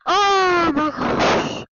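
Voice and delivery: loud, very drawn-out wail; about to cry. Real, not acted. Vlogger overwhelmed by a friend's scam, wailing near tears. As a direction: stretch it into an upset moan. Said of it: "I'm seriously about to cry".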